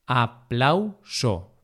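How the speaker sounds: aplauso broken slowly into a, plau, so; syllable by syllable. Shown as a demonstration. Teacher dividing aplauso for learners, syllable by syllable. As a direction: say "aplauso" in separate syllables with pauses.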